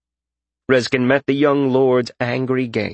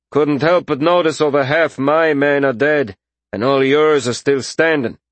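loud, raised-pitch mercenary voice; higher, forceful character voice; disgruntled mercenary leader; 5 semitones higher, 4 dB louder than usual. A narrator's reading. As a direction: challenge them as a loud, aggrieved mercenary. Narrator voices mercenary Orin.

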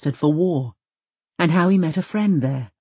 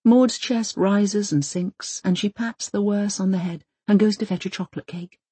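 plain reading voice

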